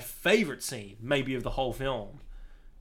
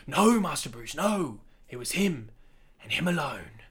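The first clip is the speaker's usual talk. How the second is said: Alfred. Retold or imitated. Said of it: "And he's like".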